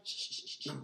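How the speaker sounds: unvoiced hushing; whispered shush. Real, not acted. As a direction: hush the set in a whisper. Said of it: "shushing before take".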